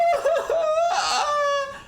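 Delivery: high giggling laugh. Real, not acted. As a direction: break up laughing after the bit. Laughing at own impression.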